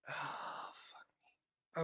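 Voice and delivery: real breathy, whispered curse; exasperated sigh. Real, not acted. Streamer cursing at the broken emulator, exasperated whispered sigh. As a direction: sigh the words out in frustration. Frustrated by emulator not working.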